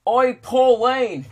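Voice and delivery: high, slow Aussie hail; exaggerated high Australian drawl; caricature Australian. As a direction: stretch it out like a hammed-up Australian calling out. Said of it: "nothing more Australian than just".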